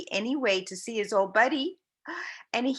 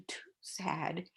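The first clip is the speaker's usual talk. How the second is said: whispering; 3 semitones lower, 12 dB quieter than usual. Acted. Unvoiced whispered line.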